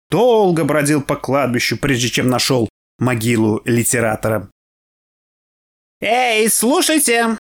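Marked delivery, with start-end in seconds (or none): 6.0-7.4 calling out. Acted. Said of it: "крикнул он".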